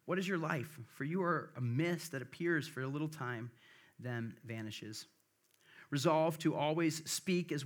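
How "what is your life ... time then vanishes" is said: normal talking voice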